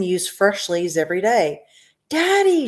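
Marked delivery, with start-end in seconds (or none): 2.1-2.7 high, loud shout for daddy; spoilt girl shouting. A narrator's reading. Spoilt girl yelling across the factory for her father. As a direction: yell for daddy like a brat who wants it now. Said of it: "shouted Veruca Salt".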